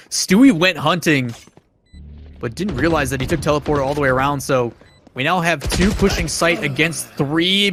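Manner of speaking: rising hype, pitch climbing high; excited play-by-play. Real, not acted. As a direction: call the clutch, building to a shout. Clutch round called live, rising.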